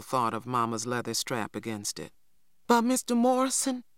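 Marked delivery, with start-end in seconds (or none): 2.7-3.8 high, urgent plea; pleading, much higher; anxious cry. A narrator's reading. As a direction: cry out anxiously to stop him. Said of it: "I cried anxiously".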